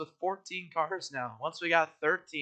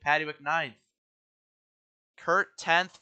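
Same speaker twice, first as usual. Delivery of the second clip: ordinary talk, nothing marked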